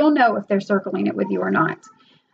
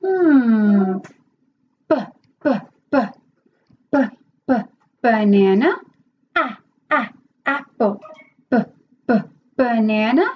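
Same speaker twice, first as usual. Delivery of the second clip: phonics teacher demo. Shown as a demonstration. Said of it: "Same thing for the next one".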